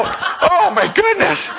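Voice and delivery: high, slow, laughing gasp; startled relief. Retold or imitated. Father startled by his son, laughing. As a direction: draw it out in shocked relief. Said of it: "And I go".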